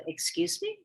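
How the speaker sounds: high, soft, puzzled question; retold baffled reply, pitched right up; mock bewilderment; taken aback.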